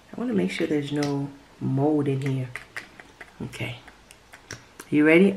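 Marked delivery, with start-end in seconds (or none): none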